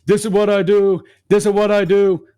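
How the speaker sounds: loud, high boastful bro chant; hammed-up flex, repeating it like a brag; bragging bro. Mocking the older guy flexing cash in the car, loud and high. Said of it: "talking about".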